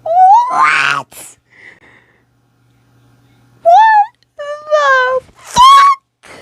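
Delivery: very high, loud stretched cry of disbelief; real shock breaking into tears. Real, not acted. Reader reacts to a sad story, crying in shock. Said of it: "you're not gonna make me cry".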